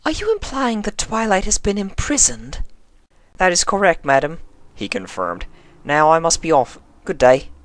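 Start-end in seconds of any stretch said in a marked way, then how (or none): 0.1-2.6 much higher, scandalised question; Rarity aghast at the news; shocked refined lady. Acted. Rarity voiced, alarmed question. 3.4-4.4 raised, stiff official voice; a nervous young officer keeping it proper; polite colt officer checking her name; formal young constable. Acted. 5.8-7.5 raised, stiff official voice; a nervous young officer keeping it proper; polite colt officer checking her name; formal young constable. Acted.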